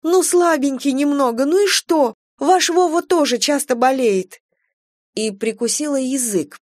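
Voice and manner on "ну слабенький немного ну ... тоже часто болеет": high, upset pleading; near-tearful protest; holding back tears. A narrator's reading. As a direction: defend him in a high, strained plea. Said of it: "с трудом сдерживала слёзы".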